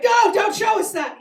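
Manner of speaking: high, loud mock horror as the crowd; lesbians recoiling. Comic voicing a shocked crowd, high loud squeal. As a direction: squeal it like a horrified audience.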